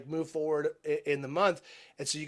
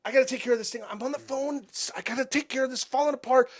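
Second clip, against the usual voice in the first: high-pitched, exasperated plea; retold yelling; 9 semitones higher than usual. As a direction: re-enact snapping at your wife, voice pitched up. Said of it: "yelled at Christy".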